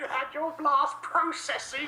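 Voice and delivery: high, slow mock old codger; old man. Guest joining in on Genesis days, mock old-man voice. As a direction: drag it out as a hammed-up old-timer. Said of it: "I'm an old man".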